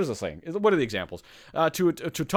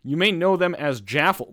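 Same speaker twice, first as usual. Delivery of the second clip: ordinary talk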